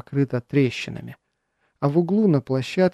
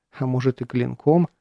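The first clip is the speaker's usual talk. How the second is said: usual speaking voice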